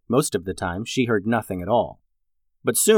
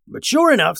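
sure pitched high and stretched; stress on sure; 12 semitones higher, 4 dB louder than usual. A narrator's reading. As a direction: lean hard into sure, high. Narrator emphasis on sure.

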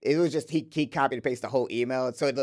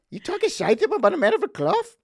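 loud, high-pitched Irish outrage; indignant mock Irish accent; 6 dB louder than usual. Shown as a demonstration. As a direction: challenge them in a hammed-up Irish accent. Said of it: "Can you do Irish accent?".

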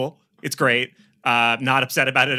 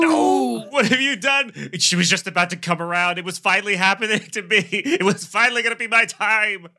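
high, wailing lament; hammed-up heartbroken wail; anguished outcry; 7 semitones higher than usual. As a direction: cry out in theatrical despair. Acted grief over lost fiancee.

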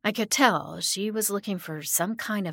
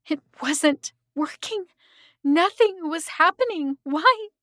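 higher and higher, building to a loud cry; rising panic; 9 semitones higher, 5 dB louder than usual. A narrator's reading. Narrator finds the banishing failing, panic building. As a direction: climb from alarm into a desperate wail.

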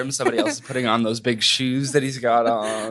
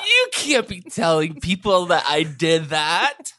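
slow, higher put-on voice of offended denial; mock protest; 4 semitones higher, slower than usual. Husband denies the joke, slow put-on protest. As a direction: drag out an indignant denial.

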